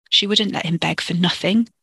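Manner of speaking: plain reading voice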